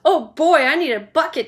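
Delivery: a touch of gleeful excitement; greedy and delighted; boy delighted by chocolate; excited boy. Acted. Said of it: "said Augustus".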